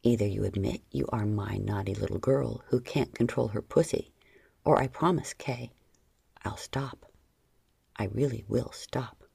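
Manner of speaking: deep firm warning; low male warning; low stern man. A narrator's reading. Lover's ultimatum.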